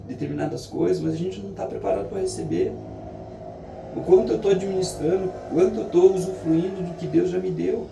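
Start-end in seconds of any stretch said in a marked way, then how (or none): none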